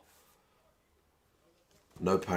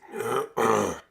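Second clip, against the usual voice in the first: loud throat clearing; 5 semitones higher, 6 dB louder than usual. Real, not acted. Hoarse commentator clears throat.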